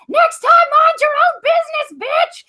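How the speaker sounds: high, loud screech out a car window; imitating a furious stranger's shout; retold yell. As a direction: shriek it at her as you drive off. Woman yells from SUV window.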